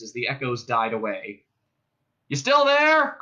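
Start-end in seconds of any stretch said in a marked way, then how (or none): 2.3-3.2 high, loud hail; calling out. A narrator's reading. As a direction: call across to check on them. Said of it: "called one of the cops".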